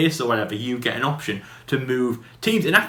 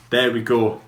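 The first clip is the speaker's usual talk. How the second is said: usual speaking voice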